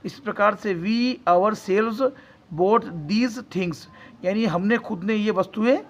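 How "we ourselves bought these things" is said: words drawn out slowly; slow dictation. Shown as a demonstration. (धीरे-धीरे बोले गए शब्द)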